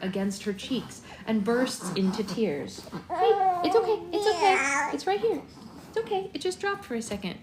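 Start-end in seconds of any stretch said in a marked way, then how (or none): 3.1-7.4 high, urgent reassurance; high soothing. Real, not acted. Mother reassuring her upset baby, high and quick.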